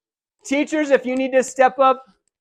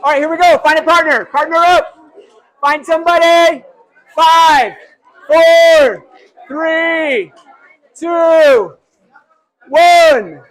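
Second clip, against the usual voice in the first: higher and louder, calling to the whole room; loud countdown; 6 semitones higher, 9 dB louder, slower than usual. Real, not acted.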